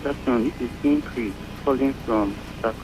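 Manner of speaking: very faint, distant caller voice; phone line too quiet to hear; barely audible. Real, not acted. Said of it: "Your voice is very faint".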